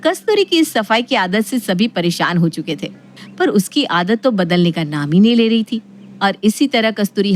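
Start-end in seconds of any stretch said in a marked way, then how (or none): none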